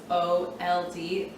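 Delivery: slow, letter by letter "O L D"; spelling out letters. Shown as a demonstration. Teacher spelling out old for students. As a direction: spell "O L D" out slowly. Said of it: "The same thing with old".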